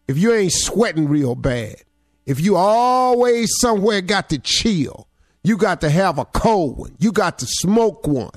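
fired-up motivational preaching. Acted. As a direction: build it like a sermon. Motivational speech preaching hard.

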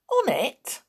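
high-pitched, baffled question; incredulous, voice pitched right up; puzzled. A narrator's reading. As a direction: ask in sheer bewilderment.

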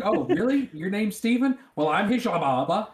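high, laughing mock surprise; mocking sarcasm. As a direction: tease the agent with a fake name back. Mocking the fake name.

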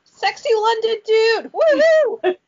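high, loud whooping cheer; excited cheer. Real, not acted. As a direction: squeal it like a fan. Cheering at listener from London.

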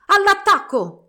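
comando gridato, molto alto, forte e lento; grido di battaglia. A narrator's reading. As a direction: lancia i soldati all'attacco. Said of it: "gridò lo schiaccianoci". (lo schiaccianoci comanda l'attacco ai soldatini, gridando forte)

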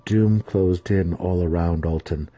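ordinary delivery, nothing marked

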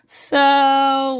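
ordinary talk, nothing marked

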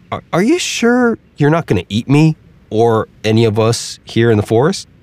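a wary squirrel grilling the alligator; suspicious squirrel. Acted.

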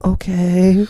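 weak little acknowledgement; feeble reply. Retold or imitated. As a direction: answer meekly as a sick person. Acts out feeble sick reply.

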